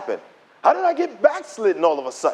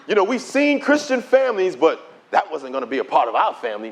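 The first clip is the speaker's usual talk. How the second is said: normal talking voice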